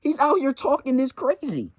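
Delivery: ordinary talk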